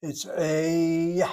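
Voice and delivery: "a" held very long and loud, gliding into a y; diphthong sound. Shown as a demonstration. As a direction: stretch "a" out into an ay-ee glide. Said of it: "this is like a diphthong".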